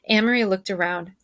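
plain reading voice

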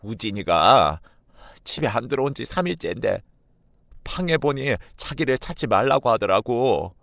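distraught mother, high-pitched lament; high, plaintive tearful complaint; tearful wailing. A narrator's reading. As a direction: act out crying while pouring out worry. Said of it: "펑펑 울면서 이야기하길".

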